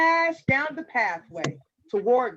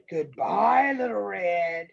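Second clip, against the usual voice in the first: low wolf farewell, slowing to a sinister aside; deep villain voice with a chuckle; sly wolf; 7 semitones lower, slower than usual. A narrator's reading. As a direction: menace hidden in a polite goodbye. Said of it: "The wolf said".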